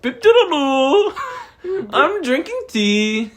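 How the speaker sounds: high, slow mock British accent, laughing; a British accent that drifts toward Indian; badly faked British accent, giggling; British accent sliding Indian. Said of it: "It goes Indian".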